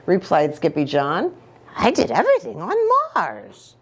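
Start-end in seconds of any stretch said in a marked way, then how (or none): 1.8-3.8 put-on proud kitty boy. Kitty boy boasts about Mars.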